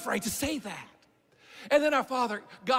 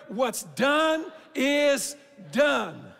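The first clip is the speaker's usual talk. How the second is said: very slow and louder, "done" shouted with a falling end; loud call to repeat; 4 dB louder, much slower than usual. Shown as a demonstration. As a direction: lead the crowd in a ringing "What's done is done.". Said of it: "can we say that together".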